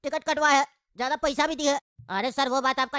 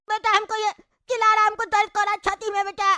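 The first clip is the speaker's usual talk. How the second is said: pained complaint; 7 semitones higher, 3 dB louder than usual. Acted. Father complains about shouting neighbour.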